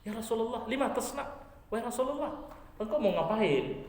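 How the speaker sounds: high, startled cry; startled mother pleading with the prophet; shocked mother. Acted. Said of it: "Maka ibunya kaget".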